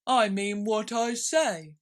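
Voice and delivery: slow, huffy high creature voice; loud slow offended turtle; offended Mock Turtle. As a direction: sulk it out, deeply offended. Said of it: "replied in an offended tone".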